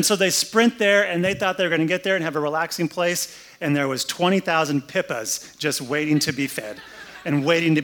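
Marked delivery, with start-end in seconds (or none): none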